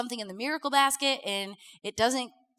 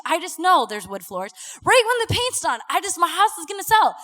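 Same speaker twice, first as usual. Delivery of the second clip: higher, louder, over-bright false cheer; sarcastic; 4 semitones higher, 7 dB louder than usual. Retold or imitated. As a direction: gush mockingly about perfect timing.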